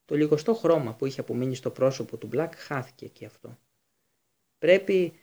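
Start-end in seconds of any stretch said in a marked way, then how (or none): 4.6-5.1 groaning plea. A narrator's reading. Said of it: "βόγγιξε ο Μπλακ".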